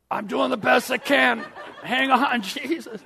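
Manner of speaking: high, loud, laughing strain; laughing struggle. Acted. Lazarus struggling out of his wrappings, calling back laughing. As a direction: play a flustered Lazarus laughing.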